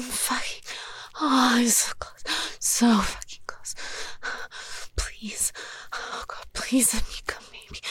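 sob-whisper the begging; crying whisper. Acted. Begging her partner for permission, crying whisper.

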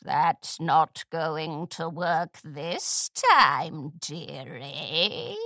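low, slow mocking hag voice; wicked old crone. A narrator's reading. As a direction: gloat like a creepy grandmother. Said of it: "gave Scootaloo a wicked smile".

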